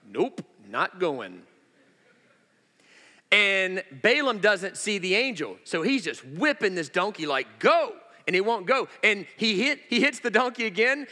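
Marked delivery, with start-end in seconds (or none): 0.1-1.4 low, slow refusal; stubborn donkey. Acted. Preacher voicing Balaam's donkey refusing the path, stubborn. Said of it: "He's like". 7.6-8.0 high, loud mock yell; exasperated command. Preacher playing Balaam whipping his donkey, exasperated yell.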